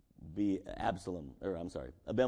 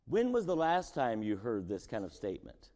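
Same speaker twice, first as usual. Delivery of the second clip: normal talking voice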